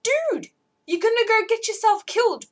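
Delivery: high, loud exasperated shout; yelling at the TV. Retold or imitated. Yelling at Rick on TV, exasperated high shout. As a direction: warn the character through the screen. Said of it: "and I'm like".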